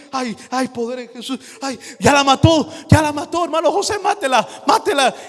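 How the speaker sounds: high, frantic pleading for help; panicked pleading. Retold or imitated. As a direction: beg someone to kill the bug, half singing. Man panics over a cockroach. (súplica aguda y frenética)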